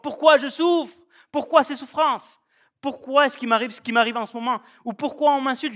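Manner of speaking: high, loud protesting questions; hammed-up complaint of a wronged man; mock outrage, voice thrown high; indignant outcry. Imagined complaint Jesus never made.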